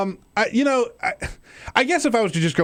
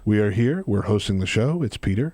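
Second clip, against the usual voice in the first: low, monotone announcing; mock monotone; 6 semitones lower than usual. Shown as a demonstration. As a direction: state the obvious as if serious and important. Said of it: "in a monotone fashion".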